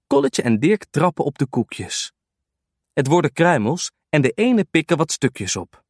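ordinary delivery, nothing marked

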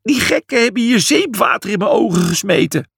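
high, upset complaint; whining. A narrator's reading. Julius blinded by the priests' water, whining. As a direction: wail about the injustice. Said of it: "jankte Julius".

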